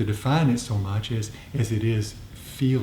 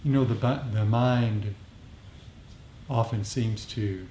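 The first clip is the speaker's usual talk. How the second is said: softer, unhurried meditation voice; guided meditation; 7 dB quieter than usual. Acted. Teacher guiding the group into metta practice, softer and unhurried. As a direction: ease the group into calm.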